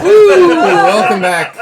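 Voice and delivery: very loud, high whoop and drawn-out greeting; whooping welcome. Real, not acted. Game master opening after the intro, loud excited whoop. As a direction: holler the welcome through laughter.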